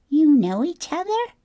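very high-pitched gosling question; curious little bird, way up high. A narrator's reading. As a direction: ask in a tiny young creature's squeak. Gosling asks, surprised.

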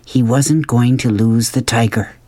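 usual speaking voice

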